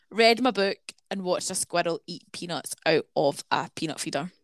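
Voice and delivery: ordinary delivery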